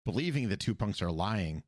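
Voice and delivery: ordinary talk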